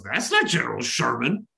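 ordinary talk, nothing marked

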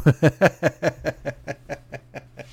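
long high giggling laugh at his own joke. Real, not acted. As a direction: crack up at yourself.

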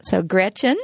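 ordinary talk

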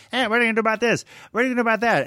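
high, pushy reporter imitation; mock reporters shouting over each other; hammering press. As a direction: fire questions as the press pack. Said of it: "press just hammering him".

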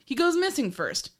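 ordinary talk

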